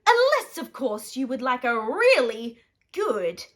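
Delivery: greedy boss changing tune; gruff boss. Acted. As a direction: shoo him off, then turn salesy. Mister Ripoff greedy boss.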